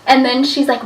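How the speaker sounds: ordinary delivery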